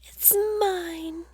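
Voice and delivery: high, light bird chirp; twittering bird. Acted. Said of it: "the bird twittered".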